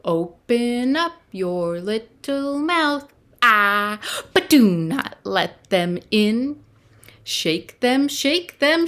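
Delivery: put-on lilting children's action rhyme, climbing in pitch through repeats; nursery rhyme. Leading little ones in a finger rhyme, lilting chant.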